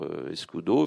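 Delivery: usual speaking voice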